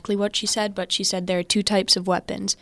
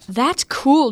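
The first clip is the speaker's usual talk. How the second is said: put-on higher, louder kid's excited cry; excited shout; 5 semitones higher, 7 dB louder than usual. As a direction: blurt it out thrilled. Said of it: "Jake screamed".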